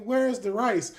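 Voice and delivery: high, loud puzzled question; imitated cartoon-like teammate. Teammate asking in the game kitchen, lightly voiced cartoon character. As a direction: lightly mimic a cute, clueless cook. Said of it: "you have this adorable cartoon character".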